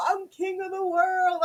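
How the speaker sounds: high-pitched imitation of a movie boast; lightly hammed-up triumphant call; mock triumphant cry. Imitating a friend on the sinking ship, mock triumphant.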